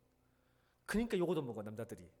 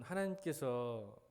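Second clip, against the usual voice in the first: ordinary talk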